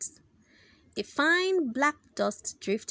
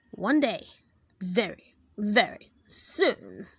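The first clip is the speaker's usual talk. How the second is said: menacing vow; 3 semitones lower than usual. Acted. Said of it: "Mister Croop vowed".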